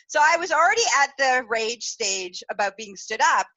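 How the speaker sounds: ordinary talk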